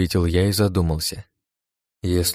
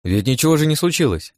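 higher-pitched, placating plea; mildly guilty, pitched up; guilty apology; 8 semitones higher than usual. A narrator's reading. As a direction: excuse yourself sheepishly. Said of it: "виновато произнёс я".

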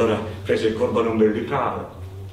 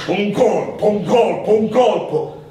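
higher and louder, hammering each colpo; building strokes; 9 semitones higher, 5 dB louder, slower than usual. A narrator's reading. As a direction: chant each colpo like an axe blow. Chopping faster, rising strain.

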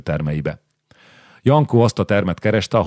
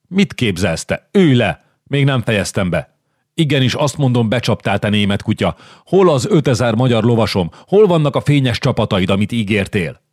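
furious king; 4 semitones higher than usual. Acted. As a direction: bark orders at a cheat. Zsigmond furious at Visconti.

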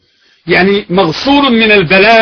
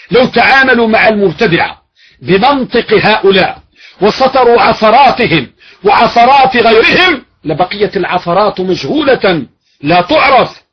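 ordinary talk

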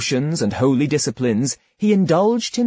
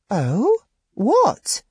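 put-on much higher puzzled girl voice; curious girl; 8 semitones higher than usual. A narrator's reading. Girl asks what she has instead, high puzzled voice. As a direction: pipe up in innocent confusion.

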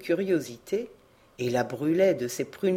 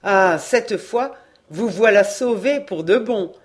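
happy reassurance; 2 semitones higher, 7 dB louder than usual. A narrator's reading. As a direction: reassure her with a wide grin. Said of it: "un large rire heureux".

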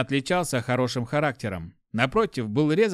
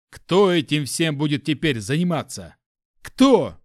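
angry rant; 4 dB louder than usual. Acted. Chief continues furious rant.

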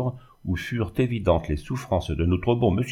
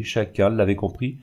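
ordinary delivery, nothing marked

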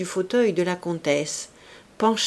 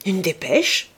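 feigned surprise; 3 dB louder than usual. Acted. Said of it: "répéta tout haut le chevalier".

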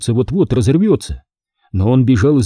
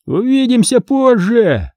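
high, slow, jeering farewell; a bully calling after the fleeing kids, slow and high; taunting call; 11 semitones higher than usual. A narrator's reading. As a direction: call it after them as a threat. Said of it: "крикнул им вслед Джоуи".

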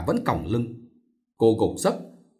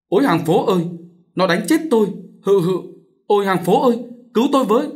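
high, loud plea to the neighbours; cry for help; 9 semitones higher, 4 dB louder than usual. A narrator's reading. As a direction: call out desperately for rescue. Vợ bị đánh kêu cứu. (kêu cứu thất thanh)

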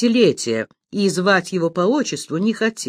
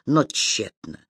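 usual speaking voice

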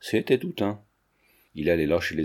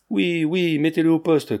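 high, raised-voice calls; a moderately acted clamour, pitched up; crowd crying out; 6 semitones higher, 3 dB louder than usual. A narrator's reading. Onlookers demand the boy be locked up, crying out. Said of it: "crièrent les autres".

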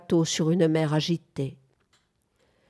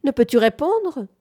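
high, raised, impatient question; piercing cry; 8 semitones higher, 5 dB louder than usual. A narrator's reading. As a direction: demand an answer in a raised voice. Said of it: "cria Bamford d'une voix perçante".

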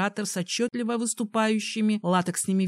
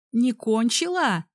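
louder, slow, almost gasping; breathless; 4 semitones higher, 5 dB louder than usual. Acted. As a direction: ask it out of breath. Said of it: "почти задыхаясь".

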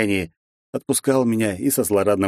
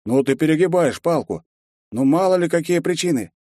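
higher, mildly chiding voice; reasonable lizard guard; 8 semitones higher than usual. A narrator's reading. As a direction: gently talk a friend down. Second guard calming partner.

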